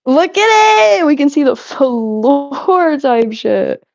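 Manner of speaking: slow, high delighted gasp; drawn-out thrilled discovery; excited. Real, not acted. Excited at the floor.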